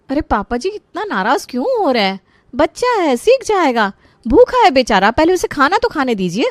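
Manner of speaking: high-pitched, lively remonstration; pleading protest. Acted. Daughter-in-law defending hungry child to father-in-law, pleading protest.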